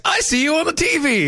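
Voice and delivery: imitating dad. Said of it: "my dad is sending me a text".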